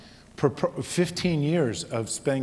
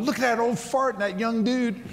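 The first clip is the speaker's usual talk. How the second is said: cheering shout; 12 semitones higher than usual. Retold or imitated. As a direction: holler approval from far off. Guys far away cheering across restaurant.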